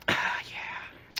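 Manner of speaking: breathy whispered mock moan; soft, voiceless sigh of mock pleasure. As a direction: imitate a stripper's sultry whisper. Imitates stripper doing her thing.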